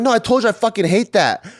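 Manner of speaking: high, irritated mock protest; exasperated retort. Daughter mimics correcting mom, high and irritated. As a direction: insist she hates it, annoyed. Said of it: "I'm like".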